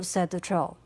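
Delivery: plain reading voice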